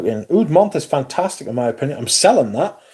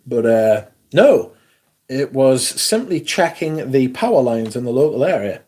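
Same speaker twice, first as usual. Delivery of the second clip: normal talking voice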